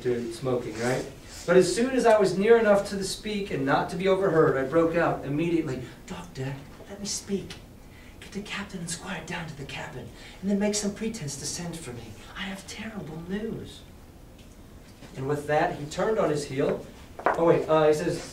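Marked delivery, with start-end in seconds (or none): 6.1-13.8 urgent hushed voice. Acted. Boy secretly warns the doctor, in an urgent hush. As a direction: keep it down so no one overhears.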